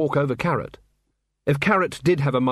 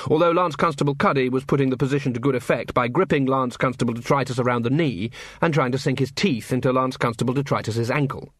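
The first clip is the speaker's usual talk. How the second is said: ordinary delivery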